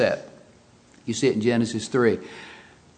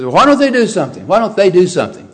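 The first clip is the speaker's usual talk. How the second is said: fast, loud, exasperated demand; hammed-up grumble of a bystander; mock complaining; 6 semitones higher, 8 dB louder, faster than usual. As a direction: whine it like an impatient bystander. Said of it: "Somebody says".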